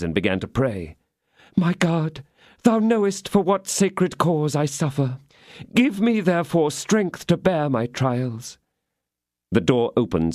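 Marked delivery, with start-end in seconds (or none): 1.6-8.6 high, pleading prayer, slowing at the end; fervent prayer. Acted. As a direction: beg heaven for strength. Theatrical prayer on her knees.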